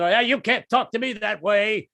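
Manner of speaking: slow, puffed-up tough-guy line; mock macho bluster. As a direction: play the big man. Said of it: "I tried the macho trip".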